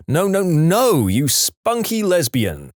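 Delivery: slow, mild mock yell; drawn-out indignant no's; sleep-talk yell. A narrator's reading. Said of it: "once yelled in her sleep".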